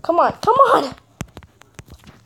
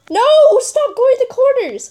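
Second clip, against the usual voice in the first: frustrated yell; 12 semitones higher, 4 dB louder than usual. Real, not acted. Yelling at player.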